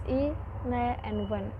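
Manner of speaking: "i, le, and wen" broken apart with a pause after each syllable. Shown as a demonstration. Student teaching the class, splitting "i, le, and wen" into separate syllables. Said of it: "Eleven has three syllables".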